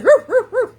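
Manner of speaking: high, loud celebratory whooping; excited whoops. Real, not acted. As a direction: cheer out loud with joy. Cheering thanks to viewers.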